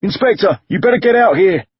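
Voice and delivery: high urgent yell. A narrator's reading. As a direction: call out in alarm. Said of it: "the driver yelled".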